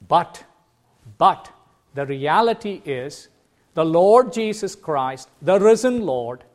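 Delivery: preacher declaring the risen Lord over hardship; full-force preaching. Acted. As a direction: drive the promise home to the congregation.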